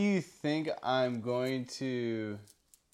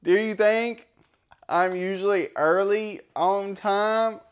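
put-on country accent; 6 semitones higher, 5 dB louder than usual. Asking his friend the card question in a put-on country accent. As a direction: ham up a Southern country voice, breaking into laughs. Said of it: "My country accent".

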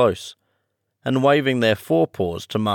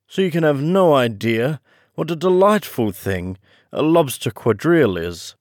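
higher, lilting storybook turtle; mildly enthusiastic turtle, pitched up; lightly voiced Mock Turtle; 6 semitones higher than usual. A narrator's reading. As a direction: tell of the dance with a touch of delight. Mock Turtle extols the lobster dance.

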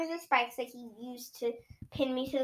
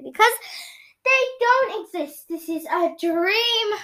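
scream; 8 semitones higher, 6 dB louder than usual. Acted. Child crying out that this must be a dream. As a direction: cry it out in disbelief. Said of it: "I screamed".

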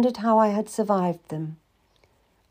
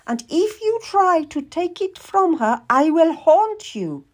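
put-on threatening old lady; 10 semitones higher, 4 dB louder than usual. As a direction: warn him in a high, forceful voice.

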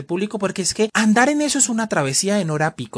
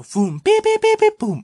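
pitidos agudos imitando las puertas; beeping bus doors; 3 dB louder than usual. Retold or imitated. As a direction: suena como el timbre del bus. (pitido agudo de puertas imitado)

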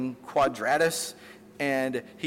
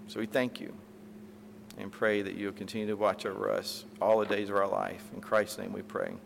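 softer, unhurried pastor's prayer voice; closing prayer; 4 semitones lower, 6 dB quieter than usual. Real, not acted. Pastor praying before communion, soft and reverent. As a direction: pray quietly over the congregation.

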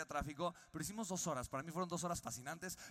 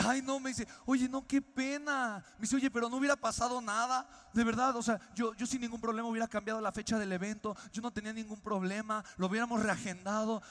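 higher and louder, distressed and apologetic; dismayed sympathy; 4 semitones higher, 6 dB louder than usual. Retold or imitated. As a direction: sound truly sorry, pained for him. John sorry about father's death.

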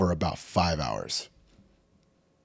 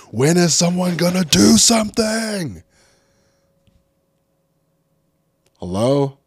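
much higher and louder, exasperated; frustrated yell; 11 semitones higher, 10 dB louder than usual. Real, not acted. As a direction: yell out for anyone to act. Frustrated outburst at inaction.

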